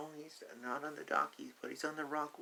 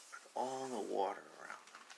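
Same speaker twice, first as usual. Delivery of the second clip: plain reading voice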